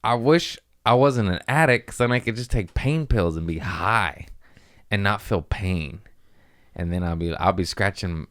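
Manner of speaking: ordinary talk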